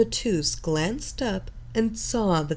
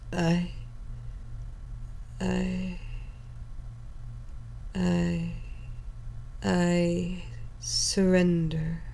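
struggling to speak; 6 dB quieter, much slower than usual. Acted. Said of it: "struggled to get the words out".

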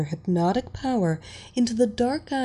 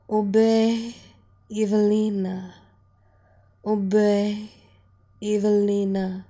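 mindless repetition. Acted. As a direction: repeat it with an empty mind. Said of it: "Anjali mindlessly repeated".

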